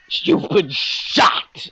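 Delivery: high, drawn-out wounded cry; hammed-up, slow animal wail; injured-deer inflection. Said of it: "infliction of an injured deer attempting to run away".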